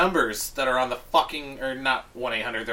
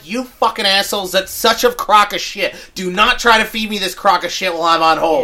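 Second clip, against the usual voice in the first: loud, raised furious rant; angry rant; 5 semitones higher, 9 dB louder than usual. Retold or imitated. Caller ranting at the hold message, loud and angry. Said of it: "I'm like".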